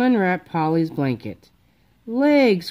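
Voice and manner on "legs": higher, delighted cry; excited cry. A narrator's reading. Froggy seeing his sister's new legs, excited cry. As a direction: announce the discovery with delight. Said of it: "cried Froggy".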